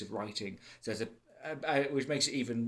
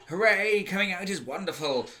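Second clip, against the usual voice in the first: sarcastic cheer, jumping high and loud on Hooray; over-the-top fake celebration; mock cheerful; 7 semitones higher, 6 dB louder than usual. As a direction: send up a sugary cliche with fake joy. Mocking the cliche coming-out story.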